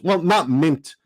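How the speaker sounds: ordinary talk, nothing marked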